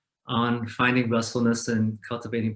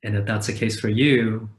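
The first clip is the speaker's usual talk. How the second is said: calm meditation guide with long, unhurried pauses; guided meditation. Acted. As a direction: guide listeners gently into rest, leaving space after each phrase.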